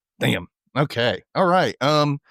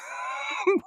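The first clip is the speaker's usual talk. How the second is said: a sudden high squeal of laughter; 6 dB quieter than usual. Real, not acted. Laughing at funny moment.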